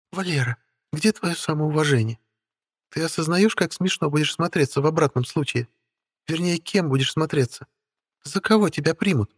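high, light voice of a spoiled beauty; haughty young woman voice. Acted. Witch Stella speaking. (капризная молодая ведьма)

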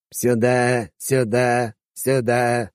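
repeating metallic speaker voice; recorded metallic call. A narrator's reading. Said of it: "повторяющийся металлический голос из динамика".

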